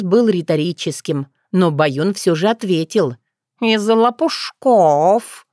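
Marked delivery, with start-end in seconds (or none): 3.6-5.5 very slow, drawn-out cat reply; talking cat. Acted. Cat answering a rhetorical question, slow and drawn-out. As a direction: stretch the last word like a smug cat.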